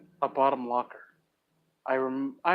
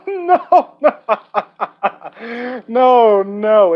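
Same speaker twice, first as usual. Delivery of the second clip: higher, louder, laughing through the words; nervous laughter; 6 semitones higher, 9 dB louder, much slower than usual. Acted. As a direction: giggle nervously while brushing it off. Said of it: "I laughed nervously".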